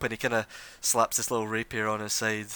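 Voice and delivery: ordinary delivery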